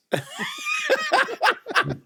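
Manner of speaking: squeaky high silly laugh; goofy falsetto cackle; weird voice laugh. Pretend. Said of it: "so I'm just gonna make a weird voice".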